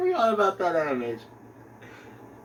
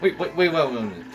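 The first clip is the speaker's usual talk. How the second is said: ordinary delivery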